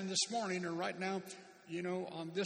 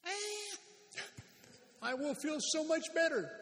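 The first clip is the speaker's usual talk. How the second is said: mock whine; 10 semitones higher than usual. Preacher acting the grumbler for the congregation, mock whine. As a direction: pout it out like a sulky child.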